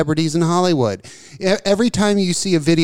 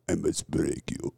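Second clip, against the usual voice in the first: much softer, lower, breathy Russian threat; Drago whisper impression; 9 semitones lower, 13 dB quieter than usual. Repeating Drago to a friend, whispered menace. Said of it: "Fucking Dolph".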